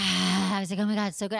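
hammed-up moan of pleasure; re-enacted moaning. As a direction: moan it out as if melting under a massage. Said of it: "I was literally moaning".

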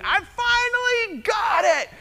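put-on high, slow, gleeful child's yell; excited shout. Friend Ellie bursting into the sanctuary, gleeful high shout. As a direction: shout it like a kid who finally won. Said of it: "at the top of her lungs saying".

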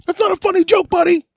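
high-pitched, loud mimicry of an indignant man; angry mock outburst. Imitating the man who took offense at the joke, high angry outburst. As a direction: squeal the complaint in a put-on voice.

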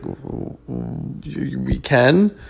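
stammered, hushed, then a doubtful question; stunned disbelief. Retold or imitated. Son stunned his dad will cut drywall in store, stammering. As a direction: trip over the start in disbelief. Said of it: "And I'm like".

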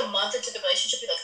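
normal talking voice

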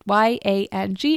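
Y A N G letter by letter; each letter of Y A N G named separately; spelled out. Shown as a demonstration. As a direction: spell yang as Y A N G for learners. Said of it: "is pronounced yang".

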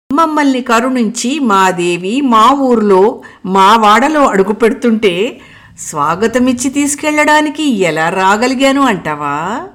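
plain reading voice

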